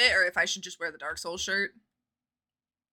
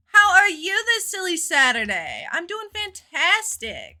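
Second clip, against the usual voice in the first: higher, louder, bouncy greeting; sugary over-the-top hello; sing-song cheer; 8 semitones higher, 6 dB louder than usual. Acted. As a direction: lay the cheer on thick.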